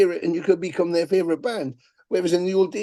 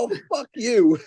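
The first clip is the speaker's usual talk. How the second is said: higher, spat-out dismissal; quoted fan's scornful thought, pitched up; scornful retort; 7 semitones higher than usual. Retold or imitated. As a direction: throw it back with contempt.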